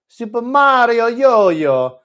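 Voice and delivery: high, loud, drawn-out mock Italian; hammed-up cartoon Italian accent, belted out slowly. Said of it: "in that ridiculous Italian accent".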